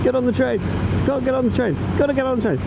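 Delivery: high, urgent playful chant; excited. Acted. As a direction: hurry yourself onto the train. Acted stunt voice.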